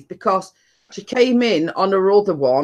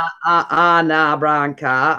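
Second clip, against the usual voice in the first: slow, lower attempt at Cockney; badly faked Cockney; 4 semitones lower than usual. Shown as a demonstration. Friend having a go at Cockney talk, half-failed Cockney accent. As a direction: fumble towards a Cockney accent. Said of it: "I can't do a Cockney accent".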